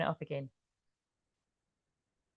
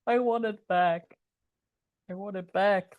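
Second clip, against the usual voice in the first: film quote. Retold or imitated. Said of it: "I'm kinda quoting that film".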